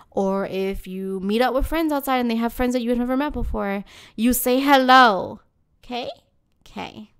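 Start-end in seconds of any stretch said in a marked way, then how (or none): 4.1-5.4 slow, louder you say hello, each word landing; emphatic moral. Real, not acted. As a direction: drive home the lesson. Moral of the story.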